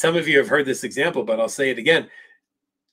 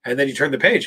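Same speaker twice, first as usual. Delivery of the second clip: ordinary talk, nothing marked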